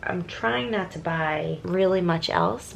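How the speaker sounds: normal talking voice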